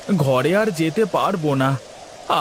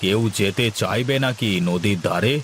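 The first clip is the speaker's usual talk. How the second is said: lower, heavier landlord voice; zamindar voice; 7 semitones lower than usual. Acted. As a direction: speak down as the village landlord. Same actor voices the zamindar.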